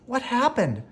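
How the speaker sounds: very high, frightened plea; terrified. A narrator's reading. Terrified Belle asks the horse what happened, very high. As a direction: ask with fear rising. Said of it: "asked terrified Belle".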